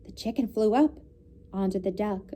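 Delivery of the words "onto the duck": ordinary delivery, nothing marked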